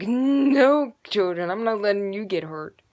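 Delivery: loud mock-motherly refusal; protective mother. [name] being the mother to the children, loud protective scolding. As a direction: scold loudly like a worried mom. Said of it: "being the mother right here".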